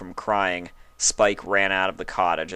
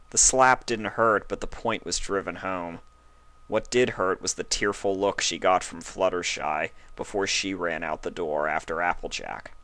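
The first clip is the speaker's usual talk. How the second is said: ordinary delivery, nothing marked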